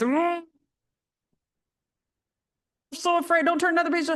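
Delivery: high, timid monster voice; fearful Grover. Acted. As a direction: plead high like a scared Muppet. Voicing fearful self as Grover.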